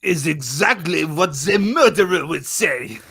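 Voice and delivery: loud, slow mock French detective; French accent. Drunk guest accusing the one keen to solve it, French accent. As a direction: declare it in a hammy French accent. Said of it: "we're doing French accents and drinking".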